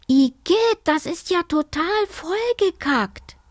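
put-on high-pitched childish disgust; disgusted girl. A narrator's reading. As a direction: squeal it like a grossed-out girl. Girl disgusted by dirty water.

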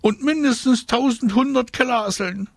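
put-on very high squeaky girl voice; high-pitched disgust; disgusted squealing. A narrator's reading. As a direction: squeal it, utterly grossed out. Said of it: "so eklig war das".

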